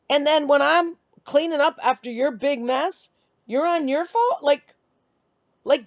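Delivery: high, indignant scolding without a full scream; retold angry rant. Woman to ex on his phone while she cleans, retold angry rant. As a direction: scold him with mounting exasperation, pitched high. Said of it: "but I was screaming".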